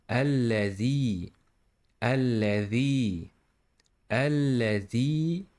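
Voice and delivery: الذي with a held doubled l; doubled lam. Shown as a demonstration. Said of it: "this is letter lam".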